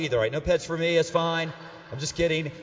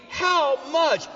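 high, loud, incredulous shout; shocked repeat; 9 semitones higher, 5 dB louder than usual. Retold or imitated. Husband shocked by wife's question about the bird, incredulous shout. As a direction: shout it back in mock disbelief. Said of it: "I was shocked she would ask me".

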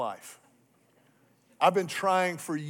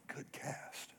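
whispered "Good cast"; 3 semitones lower, 19 dB quieter than usual. Retold or imitated. Guide quietly praising the cast, whispered. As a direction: breathe it like a hushed compliment.

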